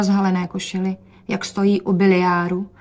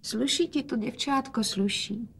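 softer, higher, tender-sounding praise; speaking quietly; 3 semitones higher, 7 dB quieter than usual. A narrator's reading. The frail father quietly praises his daughter's dress. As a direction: hush it gently to your daughter. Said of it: "hovořil otec tiše".

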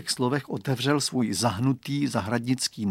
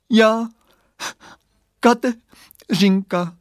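little voice torn apart by crying; high, slow words broken by sobs; acted broken sobbing; 9 semitones higher, 8 dB louder, much slower than usual. A narrator's reading. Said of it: "hlásek pláčem potrhaný na kousky".

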